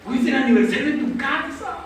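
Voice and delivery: imitated weepy prayer; retold tearful complaint. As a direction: plead like someone starting to cry. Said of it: "Y empecé a llorar".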